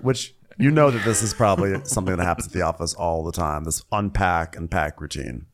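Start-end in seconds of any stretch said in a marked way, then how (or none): none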